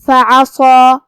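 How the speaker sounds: فعصا with a heavy, full-mouthed saad and its long alif; heavy saad demo. Shown as a demonstration. Teacher demonstrates tafkheem on the saad, heavy. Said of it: "صاد کو اور مد الف کو دونوں کو بھاری آواز".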